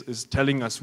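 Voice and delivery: ordinary talk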